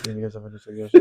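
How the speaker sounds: imitating a co-host's heavy whisper; mock whisper. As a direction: softly mimic an ASMR whisper. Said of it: "You suddenly just started whispering so heavily".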